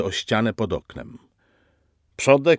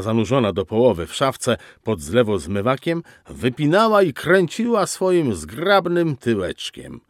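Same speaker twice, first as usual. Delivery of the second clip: usual speaking voice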